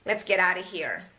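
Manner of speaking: 'Let's get out of here' as 'let's geddarr-uh here'; reduction. Shown as a demonstration. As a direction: link 'get out of' into one chunk in 'Let's get out of here'. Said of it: "itu sebenarnya adalah reduction".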